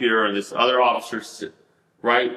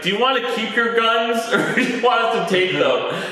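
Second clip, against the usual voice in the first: laughing through it; 9 semitones higher than usual. Retold or imitated. Retold officer question, laughing.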